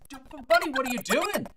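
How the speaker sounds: stammering, high, loud mock outrage; acted alarmed protest. Bartender retelling how he challenged a customer, alarmed protest. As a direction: play up the bafflement at the customer.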